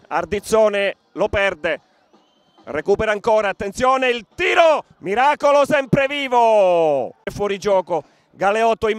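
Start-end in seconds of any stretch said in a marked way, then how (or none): 3.5-4.9 sudden shout on the shot; excited commentary shout. Real, not acted. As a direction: call the shot loudly. Shot called in live commentary.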